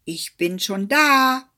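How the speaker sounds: loud smug call. Acted. Hedgehog calling to the arriving hare, loud and smug. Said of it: "rief ihm der Igel entgegen".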